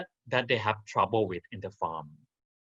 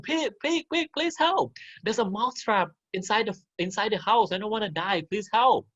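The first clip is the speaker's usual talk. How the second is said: high, pleading little mouse voice; begging mouse; 9 semitones higher than usual. Acted. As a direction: beg for help like a tiny frightened mouse. Mouse begs the pig.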